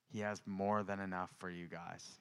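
ordinary talk

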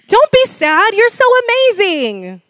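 high, hammed-up consoling coo; mock sugary reassurance; gushing parent. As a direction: overdo cheering up a sad child. Acting a parent cheering up child.

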